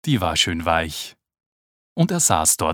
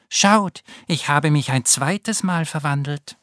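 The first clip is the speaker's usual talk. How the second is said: higher, bright happy ladybird call; cheerful call; 7 semitones higher than usual. Acted. Naoki calling joyfully to his siblings about his new colors. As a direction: show off the new look gleefully. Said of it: "rief er fröhlich".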